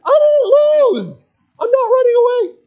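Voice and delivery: squeaky furious denial; indignant kid. Retold or imitated. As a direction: wail it like an outraged eight-year-old. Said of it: "I'm like".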